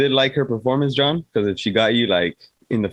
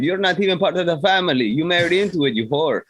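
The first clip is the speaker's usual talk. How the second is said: higher-pitched, scornful mimicry; mock put-down; 8 semitones higher than usual. Relative putting an outsider in her place, mock put-down.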